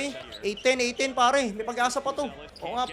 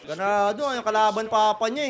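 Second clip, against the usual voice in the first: ordinary talk, nothing marked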